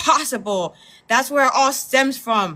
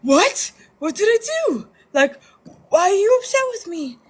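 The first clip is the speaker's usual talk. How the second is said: high, fake-innocent protest; mock innocent man; 10 semitones higher, faster than usual. Mimicking men who dodge blame, high mock innocence. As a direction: play the clueless boyfriend, pitched up and wounded. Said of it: "and they'll be like".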